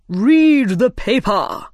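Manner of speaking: slow, loud royal order; commanding king. A narrator's reading. King ordering the Rabbit to read, commanding.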